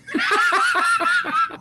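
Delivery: a burst of high, loud laughter at the joke; loud real laugh. Real, not acted. Recalling the runner-up plate, a big laugh. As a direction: laugh out loud, genuinely amused.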